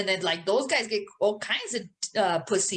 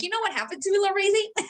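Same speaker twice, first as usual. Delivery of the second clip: much higher, laughing squeaky princess whine; 10 semitones higher, faster than usual. Pretend. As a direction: squeak it like a little princess, giggling. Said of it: "Meanwhile, I'm like".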